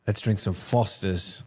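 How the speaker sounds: slow put-on Australian accent; a lazy, hammed-up Aussie accent. Co-host joining the Australian joke with a slow Aussie accent. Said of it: "let's get down under".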